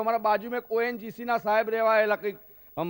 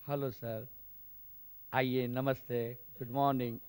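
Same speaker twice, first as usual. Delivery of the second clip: much softer and lower, slow and dainty hostess greeting; delicate air hostess; 7 semitones lower, 7 dB quieter than usual. Retold or imitated. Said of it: "સરસ મજાની આમ નાજુક".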